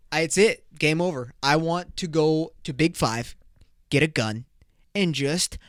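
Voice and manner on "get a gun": ordinary talk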